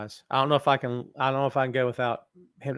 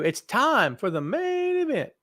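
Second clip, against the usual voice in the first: drawn-out slow ring announcer call, higher; 8 semitones higher, slower than usual. Shown as a demonstration. Host trying out the show's catchphrase, slow announcer call. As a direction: stretch it out like calling the main event. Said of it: "I think I'm just gonna do".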